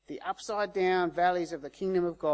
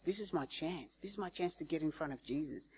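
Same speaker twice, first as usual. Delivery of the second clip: soft, private thought; quietly urgent, as if to oneself; hushed realization; 9 dB quieter than usual. Retold or imitated. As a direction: voice the beggar's sudden realization under the breath. Beggar realizes his chance, to himself.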